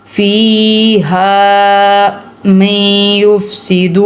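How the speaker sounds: فی ہا stretched only two counts; medium lengthening. Shown as a demonstration. Teacher shows medium madd on فی ہا. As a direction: hold فی ہا long, not too long. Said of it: "بہت زیادہ لمبا نہیں کرنا".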